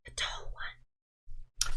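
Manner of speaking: slow, breathy stage whisper; whispered confidential aside. Acted. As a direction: hide it from the tall one, slowly.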